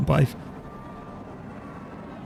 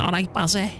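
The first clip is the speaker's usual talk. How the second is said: put-on Scouse accent; 6 semitones higher than usual. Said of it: "She's saying in a Scouse accent".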